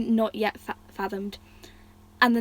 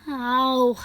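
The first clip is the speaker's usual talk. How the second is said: whined; 3 semitones higher, 4 dB louder than usual. Acted. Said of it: "he whined".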